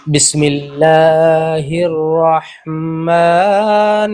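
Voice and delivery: slow chanted بسم اللہ الرحمن الرحیم; Quranic recitation. Shown as a demonstration. Teacher modelling for a student, slow recitation. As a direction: intone it slowly as in tajweed.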